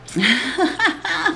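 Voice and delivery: high breathless giggle; giggle, flustered and giddy. Real, not acted. Giggling to viewers about her date. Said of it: "look at me, I'm getting all giddy".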